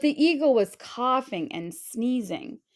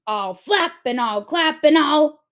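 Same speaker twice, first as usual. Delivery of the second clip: higher, louder eagle threat cut off; 5 semitones higher, 6 dB louder than usual. A narrator's reading. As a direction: start the squawking threat, broken off.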